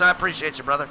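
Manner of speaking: usual speaking voice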